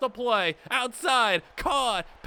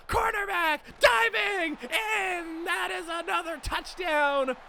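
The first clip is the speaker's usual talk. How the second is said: excited shout; 6 semitones higher than usual. Real, not acted. Another touchdown celebrated.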